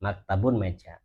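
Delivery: normal talking voice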